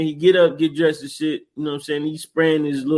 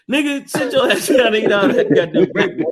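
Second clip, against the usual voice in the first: higher, scolding dad voice; dad scolds son over breakfast, laughing; mock angry dad; 6 semitones higher than usual. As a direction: bark at the son to eat. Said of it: "felt the disrespect".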